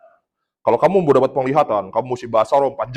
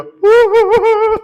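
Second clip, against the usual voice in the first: loud, very high-pitched wailing; tangisan tiruan; 6 dB louder than usual. Retold or imitated. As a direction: mimic her sudden high wail. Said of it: "dia nangis, muter-muter". (menirukan tangis melengking)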